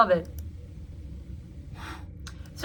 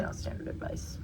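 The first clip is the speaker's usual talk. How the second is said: whispered; 13 dB quieter than usual. Real, not acted. Trailing off in a whisper.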